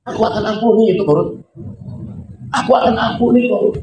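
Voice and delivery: God chides Musa for harshness. Acted. (Tuhan menegur Musa dengan lembut)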